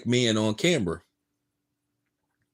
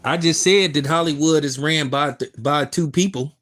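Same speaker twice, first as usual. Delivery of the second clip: heated and defensive; 5 semitones higher than usual. Real, not acted. Heated reply to a chatter.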